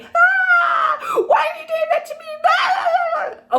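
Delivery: mock hysterical shriek, very high and loud; hysterical woman. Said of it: "women are often depicted as hysterical".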